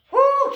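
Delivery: loud high woo; excited whoop. Real, not acted. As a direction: cheer out a sharp woo. Instructor cheering mid squat.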